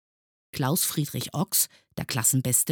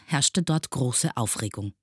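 ordinary delivery

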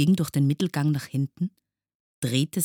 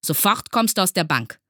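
loud, higher, sharp command; stern order; 6 semitones higher, 6 dB louder than usual. A narrator's reading. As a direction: order the pupil out at once. Teacher orders pupil out. (streng und laut befohlen)

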